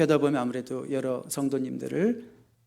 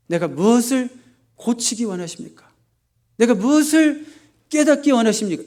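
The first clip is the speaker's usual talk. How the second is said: raised, building plea to God; earnest prayer; 7 semitones higher, 6 dB louder than usual. Acted. Believer asking God what to learn, earnestly pleading. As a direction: ask insistently, louder with each question.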